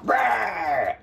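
big playful roar; a hammed-up monster roar. Monster roars at boy.

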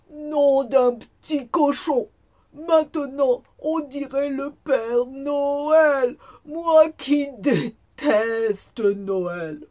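wolf's high, booming outcry; hammed-up loud, slow protest; indignant exclamation. A narrator's reading. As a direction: exclaim in disgust, stretching the words out. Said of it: "et s'exclama".

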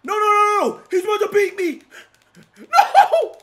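high, loud laughing screams of no; laughing panic. Real, not acted. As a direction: shriek through laughter as you lose. Panicked laughing as he's losing.

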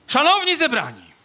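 very high, loud ceremonial call. Acted. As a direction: open the eulogy in a high, belted singer's voice. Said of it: "takim [name] pan troszeczkę zalatuje".

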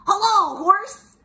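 high, loud sing-out greeting; cheery high-pitched character hello; Duck. Acted. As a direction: call out hello in a high storybook voice. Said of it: "said Duck".

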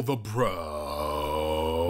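BRO dropped low and held long; low, lingering surfer-like BRO; drawn-out BRO. Shown as a demonstration. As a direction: sink low and stretch out BRO. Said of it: "you were lagging out the".

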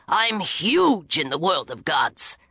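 low, slow, gruff boast; boastful dwarf god. A narrator's reading. Dwarf bragging to the girls, low gruff boast. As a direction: puff up with pride.

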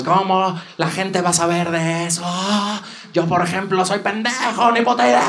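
mock outrage, pitch climbing as it goes; rising mock indignation; indignant crowd. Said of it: "todos indignados".

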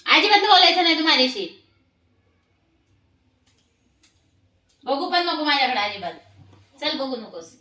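angry scolding. Real, not acted. Mother scolding the pet for climbing, loud angry scolding. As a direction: scold the naughty pet hard.

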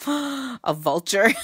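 high surprised gasp then laughter. Real, not acted. Spots a vulture shape, laughs.

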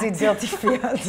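laughing through a put-on German dirty fiat; mock German accent. Co-host mimicking the clip guy, laughing mock German. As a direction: play the clip's German voice, giggling.

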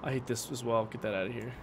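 normal talking voice

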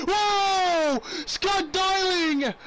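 high, drawn-out, loud cry of shock; stunned shout. Real, not acted. Gamer yelling in shock at the goalie play. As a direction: yell the goalie's name in disbelief.

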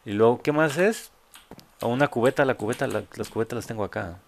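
ordinary talk, nothing marked

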